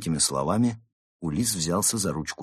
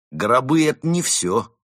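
merciless smirk; 7 semitones higher, 7 dB louder than usual. A narrator's reading. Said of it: "с безжалостной ухмылкой сказал".

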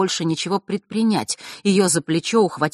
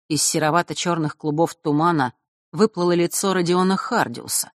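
ordinary delivery

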